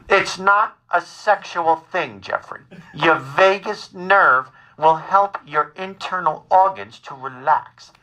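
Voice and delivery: slow, measured mother impression; calmly insistent mother. Son imitates his worried mother, calmly insistent. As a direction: stretch each word out like a patient, insistent mother. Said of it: "my mother is very calmly telling me".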